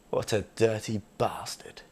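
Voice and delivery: hushed, breathy aside; a sly whispered dig at Buster. Real, not acted. Comic whispering a dirty dig about Buster.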